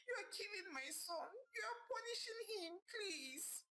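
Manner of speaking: high, crying plea rising to tears; sad pleading. Acted. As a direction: beg her to stop, breaking into sobs. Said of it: "pleaded with her sadly".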